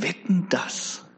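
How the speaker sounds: slow, whispered wager; sly whispered challenge from the devil; daring whisper. Acted. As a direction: breathe the bet like a sly dare. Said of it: "ganz verwegen und sagt".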